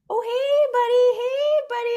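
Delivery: put-on high sing-song baby talk to a bug; squeaky high greeting for a little critter; pet talk. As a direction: coo at it like a puppy.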